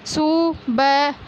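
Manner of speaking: सुबह drawn out slowly, syllable by syllable; slow sounding out. Shown as a demonstration. Teacher to pupils, stretching the word out slowly. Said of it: "इस प्रकार पढ़ना है".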